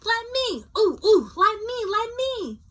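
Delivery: begging to get a turn; eager clamoring. Acted.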